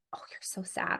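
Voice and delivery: hushed, gentle sympathy; soft, near-whispered mirroring; soft soothing voice. Shown as a demonstration. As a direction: breathe it softly to a sad child. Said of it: "you might say".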